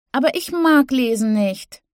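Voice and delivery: put-on high, whiny little-girl voice; whining child. A narrator's reading. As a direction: whine it like a small kid. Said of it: "jammerte Winnie".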